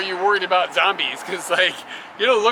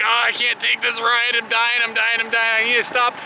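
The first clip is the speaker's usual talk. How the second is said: mock whining butt voice; 5 semitones higher, 4 dB louder than usual. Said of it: "my butt is like".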